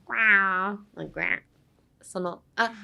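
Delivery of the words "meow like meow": creaky cat yowl in the throat; cat meow. Shown as a demonstration. Teacher showing the throat sound for 'granted', catlike meow. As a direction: imitate a cat's weird meow. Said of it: "weird noise that your cat sometimes makes".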